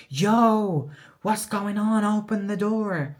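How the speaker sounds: high, loud call through a closed door. Retold or imitated. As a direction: shout to someone inside, slowing on open the door. Brother calling through the door.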